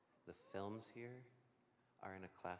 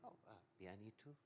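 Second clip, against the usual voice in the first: American accent Spanish; 2 semitones higher, 7 dB quieter, faster than usual. Real, not acted. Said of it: "pero mi español".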